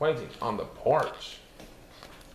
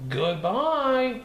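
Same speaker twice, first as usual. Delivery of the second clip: bright goodbye; 9 semitones higher than usual. Acted. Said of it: "she says".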